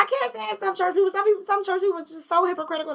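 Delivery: high-pitched exasperated rant; going off. Retold or imitated. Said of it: "I'm going off".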